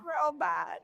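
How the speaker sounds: soft high mock diva voice; joining the Coolidge impression. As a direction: echo her like a dreamy diva. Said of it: "your Jennifer Coolidge is so good".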